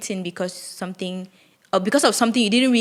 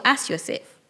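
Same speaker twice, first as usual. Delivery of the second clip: normal talking voice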